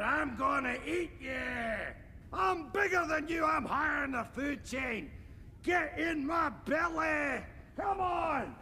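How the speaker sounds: shouting. Acted. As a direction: bellow it like a hungry ogre. Chasing Mini-Me, yelling threats.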